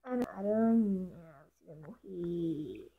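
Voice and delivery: low, slow, creepy threat; menacing villain. Pretend. To a spider in the game, a low slow menacing threat.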